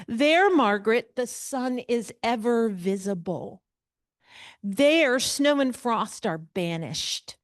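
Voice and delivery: reading the letter aloud with a grand lilt; dramatic reading. Acted. Teacher reading the Frankenstein letter to the class, dramatic. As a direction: lean into the ornate prose.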